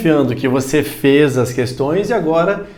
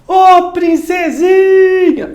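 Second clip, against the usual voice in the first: high, loud call with long "ô" and long "si" in "princesinha"; stretched-out shout; 14 semitones higher, 5 dB louder, much slower than usual. Shown as a demonstration. Teacher demonstrates shouting to a princess, high drawn-out call on "princesinha". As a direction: holler it out, holding the penultimate syllable of "princesinha". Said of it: "Se você fosse gritar".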